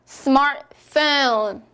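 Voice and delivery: 스마트폰 slowly as "SMAR-fone", T dropped; English pronunciation drill. Shown as a demonstration. Teacher leads learners repeating aloud, English pronunciation. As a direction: model 스마트폰 slowly in two English beats, no T. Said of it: "소리 크게 내어볼게요".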